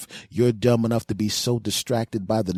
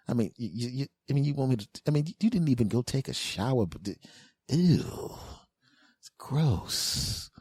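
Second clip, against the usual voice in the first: disgusted; 4 semitones higher, 4 dB quieter than usual. Retold or imitated. Disgusted refusal.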